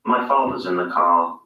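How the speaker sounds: British English demo sentence. Shown as a demonstration. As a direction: say it in received pronunciation, no R in father's or car. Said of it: "Listen to the difference".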